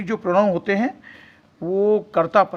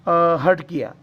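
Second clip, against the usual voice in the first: usual speaking voice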